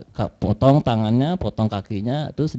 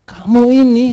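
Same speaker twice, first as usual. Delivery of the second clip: higher, louder mock scolding; retold angry scolding; 8 semitones higher, 7 dB louder, slower than usual. Dead woman scolds the grave robber in his dream, retold angrily. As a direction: scold him like a wronged woman. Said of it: "datang marah-marah".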